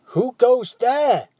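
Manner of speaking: loud, high, slow shouted demand; a guard demanding an answer; demanding challenge. Acted. Approaching sentry demanding who is there, loud. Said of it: "demanded the approaching voice".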